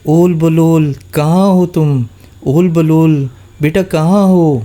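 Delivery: put-on high, loud frantic calls for her kids; frightened calling. A narrator's reading. Mother goat frantically calls her missing kids, high and loud. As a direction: call out in panic for the lost kids. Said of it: "گھبرا کر بچوں کو آوازیں دینے لگی".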